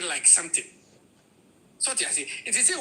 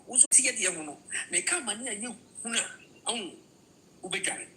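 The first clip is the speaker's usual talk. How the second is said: ordinary talk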